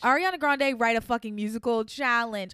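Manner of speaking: loud, emphatic demand; emphatic shout. Real, not acted. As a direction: shout it like a rallying call. Repeats the challenge shouting.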